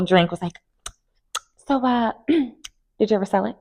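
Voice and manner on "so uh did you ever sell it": airy, offhand question in a higher voice; casual. Retold or imitated. Drew casually needling Britt over drinks, offhand and airy. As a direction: toss it out like it's nothing. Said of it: "She said it so casually".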